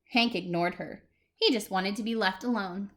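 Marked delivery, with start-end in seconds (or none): none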